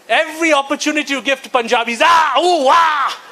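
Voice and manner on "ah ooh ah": loud high whooping cheer; Punjabi whoop. Retold or imitated. Comic imitating Punjabis cheering, loud and high. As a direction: whoop like a rowdy Punjabi in an SUV. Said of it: "shouting all the time".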